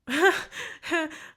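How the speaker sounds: short high laugh of disbelief; incredulous laugh. Real, not acted. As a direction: laugh in shock at what you just heard. Shocked at uncle's words.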